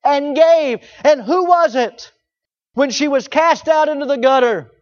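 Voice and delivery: rising pulpit fervor; full-force preaching. Acted. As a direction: preach it out to the back pews. Preacher building to climax.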